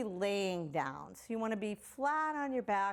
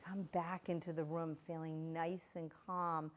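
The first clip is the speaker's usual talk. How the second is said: guided visualization; 4 dB quieter than usual. Acted. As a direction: lull the children gently into a calm beach daydream.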